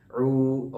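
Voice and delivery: عو with lips fully rounded, from the throat; rounded ain. Shown as a demonstration. Teacher showing students the rounded ain. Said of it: "منہ کو مکمل گول کرنا".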